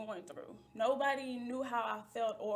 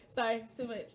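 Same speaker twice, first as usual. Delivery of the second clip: laughing; 4 dB louder than usual. Real, not acted. Apologising through laughter.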